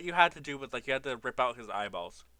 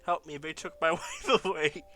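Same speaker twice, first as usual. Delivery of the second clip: hammed-up cry for help, higher and louder; mock distressed plea; 5 semitones higher, 8 dB louder, slower than usual. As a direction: plead like a wronged snow golem husband, half laughing. Voicing snow golem's plea.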